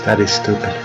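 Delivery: ordinary talk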